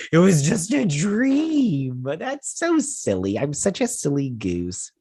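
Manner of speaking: high, drawn-out mock relief; hammed-up silly waking-up voice, stretched slowly; relieved dreamer.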